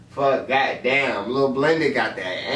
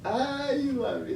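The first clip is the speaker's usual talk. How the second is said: teasing, pitched way up; high teasing coo; 11 semitones higher, 7 dB quieter than usual. Real, not acted. Teasing his co-host.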